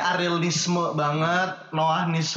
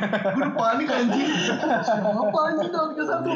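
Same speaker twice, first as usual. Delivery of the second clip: laughing; 9 semitones higher than usual. Real, not acted. Panicked over the date mix-up.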